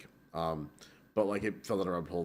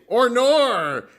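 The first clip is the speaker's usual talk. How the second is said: much higher, louder imitation of Australian "Or nor"; Australian slang; 13 semitones higher, 12 dB louder than usual. Telling a friend about Aussie slang, high mock Australian. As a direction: chirp "Or nor" like young Aussies. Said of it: "a younger Australian thing where they say".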